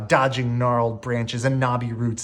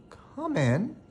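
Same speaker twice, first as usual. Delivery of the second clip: reluctant; 11 semitones higher than usual. A narrator's reading. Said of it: "said Belle reluctantly".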